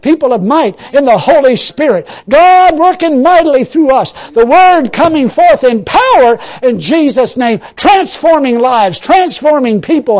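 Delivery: loud, rising preaching prayer; fervent prayer. Acted. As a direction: pray it out with full conviction.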